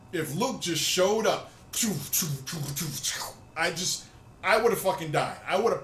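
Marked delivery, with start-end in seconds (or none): 1.7-3.2 lightsaber swooshes. Retold or imitated. Comedian imagining Luke showing up to fight, saber whooshing noises. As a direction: mouth sounds of a saber swinging.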